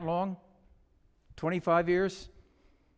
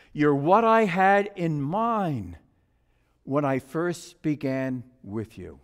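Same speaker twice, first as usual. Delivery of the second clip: tender affirmation; 6 semitones lower, slower than usual. Acted. Master to the finished teacup, tenderly affirming. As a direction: let each word land slowly and sincerely.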